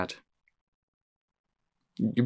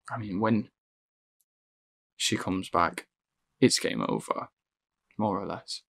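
quiet dread; 5 semitones lower, 9 dB quieter than usual. Acted. Grim realisation.

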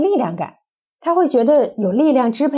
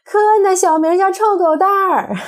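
higher, louder gleeful shout; 9 semitones higher, 4 dB louder than usual. Retold or imitated. Child shouting the doctor's secret nickname, triumphant yell. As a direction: yell it out to tease. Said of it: "马上喊起来".

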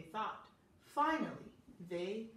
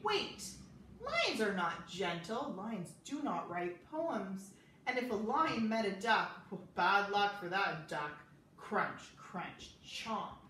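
loud, scornful know-it-all; scoffing doubter; 6 dB louder than usual. Acted. Doubters scoffing at a gentle lion, loud and scornful. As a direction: insist loudly that lions aren't gentle.

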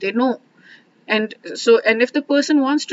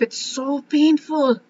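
real pain; 6 semitones higher, slower than usual. Real, not acted. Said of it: "I'm getting emotional".

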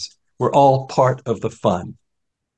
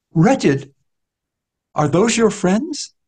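higher, slowed, bitter question; a hint of the beggar scoffing; the beggar repeats Newman's word, lightly voiced; lightly voiced blind beggar; 8 semitones higher, slower than usual.

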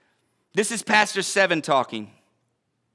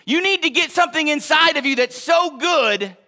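ordinary talk, nothing marked

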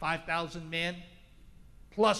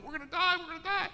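panicked cry; 13 semitones higher, faster than usual. Acted. Disciples panicking in the storm.

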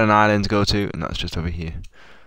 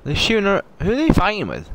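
high, loud startled calls; excited; 9 semitones higher, 4 dB louder, faster than usual. Real, not acted. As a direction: blurt it out in alarm. Sudden fight on screen.

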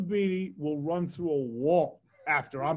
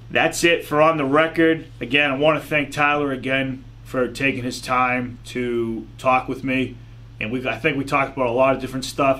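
podcast outro; 8 dB louder than usual. Acted. Closing the show for listeners in a louder sign-off. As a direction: wrap up the show for the audience.

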